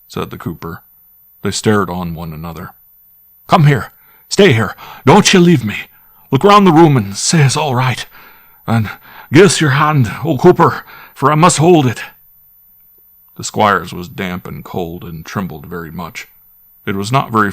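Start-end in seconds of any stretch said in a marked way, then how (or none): 3.5-12.0 higher and louder, desperate clinging plea; terrified pleading. Acted. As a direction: beg him not to leave, frightened out of your wits. Terrified squire clings to butler.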